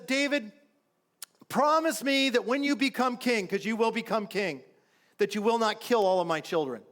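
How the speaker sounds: high, imitated tearful plea; Saul, mock sobbing. Saul begs David to spare his children, mock sobbing. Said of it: "It says he starts sobbing".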